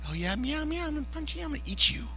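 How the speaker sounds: hushed, high muttered threat; playful threat under the breath; mock menace. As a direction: mutter it softly like a crazed hungry person. Cranky faster threatening kittens.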